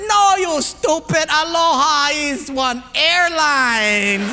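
slow, high, drawn-out holler; exasperated put-down. Acted. Portuguese guy corrects the holy guy, loud and exasperated.

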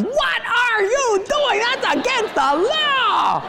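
mock the panicked wife as she yells; wife yelling. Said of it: "She's just yelling".

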